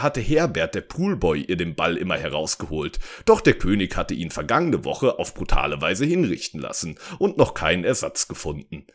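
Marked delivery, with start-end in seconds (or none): none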